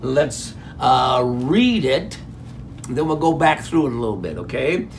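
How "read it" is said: ordinary delivery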